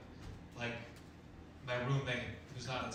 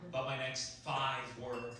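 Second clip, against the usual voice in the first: ordinary delivery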